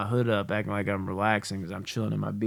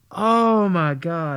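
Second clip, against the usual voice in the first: loud, drawn-out, raised feigned dismay; mock horror; 6 semitones higher, 8 dB louder, much slower than usual. Prankster feigning shock at daughter's mess, mock-horrified. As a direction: groan it long in pretend shock.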